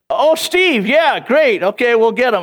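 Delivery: high-pitched, chirpy phone recognition; eager office greeter. Retold or imitated. Office staff recognising him on phone.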